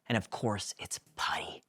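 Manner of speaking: hushed narrator aside; whispered aside. A narrator's reading. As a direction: whisper the punchline. Narrator aside about Puddy.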